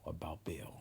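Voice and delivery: hushed conspiratorial whisper; secretive whisper to viewers. Real, not acted. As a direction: lean in and whisper the aside. Conspiratorial aside about Bill.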